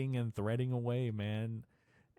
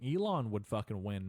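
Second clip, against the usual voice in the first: usual speaking voice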